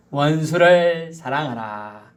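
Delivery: slow, each word weighted, 원수를 stressed; slow emphatic proclamation. Acted. Preacher announcing the verse, slow and weighty. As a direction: proclaim 원수를 사랑하라 slowly and firmly.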